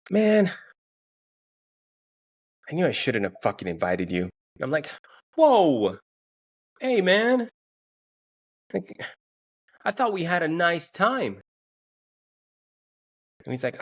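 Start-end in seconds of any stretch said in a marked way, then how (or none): 0.1-4.2 mock-pissy, huffy sigh before speaking. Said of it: "this dude gets kinda pissy". 5.4-11.4 high-pitched, startled mock objection; taken-aback protest. Acted hurt protest at being scolded.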